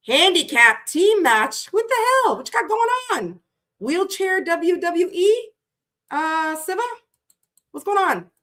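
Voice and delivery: high, incredulous questions; baffled. Real, not acted. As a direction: react like you can't believe what you read. Baffled reaction to chat comment.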